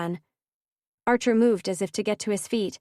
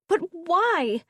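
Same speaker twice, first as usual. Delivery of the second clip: much higher, a pained cry; cried out; 10 semitones higher than usual. A narrator's reading. Ridley to her best friend holding a gun, anguished cry. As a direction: cry out in disbelief. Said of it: "Ridley cried".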